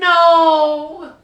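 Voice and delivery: laughing. Real, not acted. Laughing refusal to sing.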